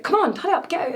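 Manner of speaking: loud, high, rushed mother; flustered hurry-up. Retold or imitated. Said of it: "getting really flustered and frustrated".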